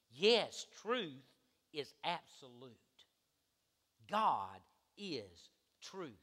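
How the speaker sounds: ordinary talk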